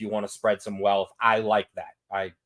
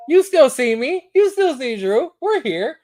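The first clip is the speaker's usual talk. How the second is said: hyped-up squeaky voice; 13 semitones higher, 7 dB louder than usual. Pretend. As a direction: squeak it out like a cartoon hype man. Joking about sliding over on screen.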